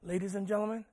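very soft, with long weighty pauses; hushed, solemn preaching. Real, not acted. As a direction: draw them in quietly, phrase by phrase.

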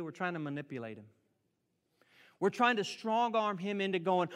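ordinary talk

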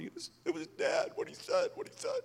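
put-on high, tearful little boy's voice; crying young son. Son tells mother through tears, high tearful voice. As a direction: pitch way up like a sobbing child.

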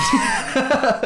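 sudden high-pitched laugh. Real, not acted. Laughing at the joke.

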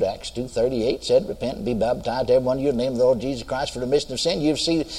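low, soft run-through of a memorized verse; recited low, hurrying through the middle; rote recitation. Retold or imitated. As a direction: reel the verse off quietly under your voice.

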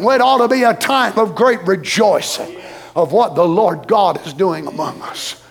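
normal talking voice

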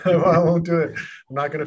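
amused, firm no to the accent; laughing refusal. Real, not acted.

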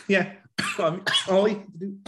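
coughing mid-sentence; choking on a sip; coughing up water. Real, not acted. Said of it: "my water went down the wrong tube".

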